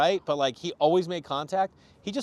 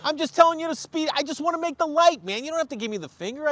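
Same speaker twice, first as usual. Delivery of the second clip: high, loud, strained protest; retold indignant rant; exasperated plea; 12 semitones higher, 5 dB louder than usual. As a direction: plead your case at a high pitch. Acted confrontation with rude driver.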